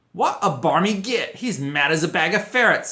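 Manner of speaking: loud, high gleeful jeer; loudly. A narrator's reading. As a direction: call it out loudly. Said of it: "said Fred loudly".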